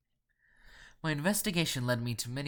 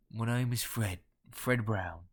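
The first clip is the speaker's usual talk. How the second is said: rough Cockney introduction; guttural nasal Cockney; slower than usual. A narrator's reading. Londoner introducing himself by phone, in guttural Cockney. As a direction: give the name in throaty Cockney. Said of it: "guttural yet nasal Cockney accent".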